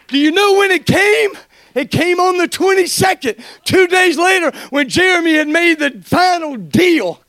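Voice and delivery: loud, fervent preaching with shouts of praise; preaching at full force. Acted. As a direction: preach it, lifting the church.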